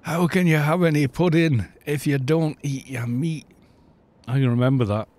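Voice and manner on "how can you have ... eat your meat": quoting teacher. Retold or imitated. Quoting Pink Floyd teacher.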